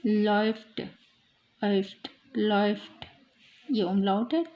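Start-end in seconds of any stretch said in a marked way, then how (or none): 0.1-3.0 लॉफ्ट with an "oy" diphthong, like "loyft"; ओए sound. Shown as a demonstration. Teacher repeating the example, लॉफ्ट said as "loyft". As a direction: glide through "oy" in लॉफ्ट. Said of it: "ओए".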